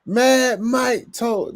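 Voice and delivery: very high, stretched-out catchphrase; Mad Mike. Retold or imitated. Host imitating Mad Mike's catchphrase, high slow holler. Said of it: "That's what he was saying".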